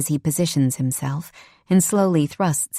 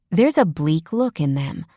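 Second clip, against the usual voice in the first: usual speaking voice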